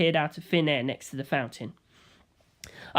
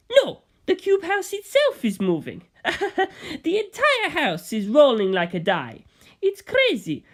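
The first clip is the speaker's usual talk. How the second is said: higher, laughing disbelief; amused gangster; 9 semitones higher than usual. Acted. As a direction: chuckle at the crazy house. Bucciolati voiced, amused.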